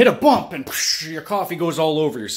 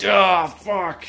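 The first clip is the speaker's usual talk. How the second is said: a jolted, annoyed curse; mock frustration; 3 semitones higher, 3 dB louder than usual. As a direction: act out waking up annoyed. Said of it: "And then you're like".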